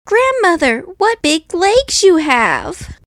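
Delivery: put-on high, wondering little girl; a girl marvelling at her granny; the girl in bed asking her grandmother, high and amazed. A narrator's reading. Girl voiced in story.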